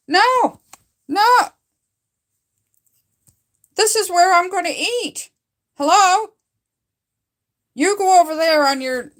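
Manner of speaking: shooing the cat off her chair, high and loud; talking to the cat. Real, not acted. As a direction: shoo the cat off in a high playful scold.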